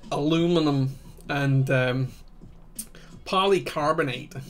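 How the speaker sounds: terrible American accent. Shown as a demonstration. Streamer showing viewers how Americans say it, terrible American accent. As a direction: put on a bad, loud American accent. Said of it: "That's my terrible American accent for you".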